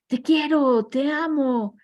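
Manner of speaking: high, loud loving call. Retold or imitated. Father shows his son the echo, calling lovingly aloud. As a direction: call out the words so the valley answers. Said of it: "ahora voy a decir".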